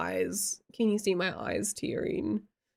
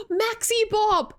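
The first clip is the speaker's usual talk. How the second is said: a real yell of delighted recognition; greeting a chat friend with a happy shout; excited yell; 12 semitones higher, 5 dB louder, slower than usual. Real, not acted. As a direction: call out the name loud and high, slowly. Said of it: "I'm sorry for yelling just now".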